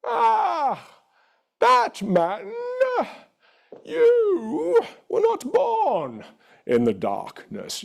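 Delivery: high, very slow theatrical villain voice; DC villain impression. Shown as a demonstration. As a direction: grandiose comic-book villain, stretching each word. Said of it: "Guess which character I am right now".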